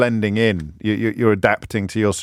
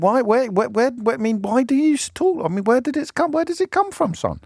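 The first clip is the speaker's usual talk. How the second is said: baffled, high-pitched stammering questions; questioning parent; 11 semitones higher than usual. Acted. Host voicing a puzzled mother questioning her son, incredulous. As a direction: stumble through it, voice pitched right up. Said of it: "Have you ever said".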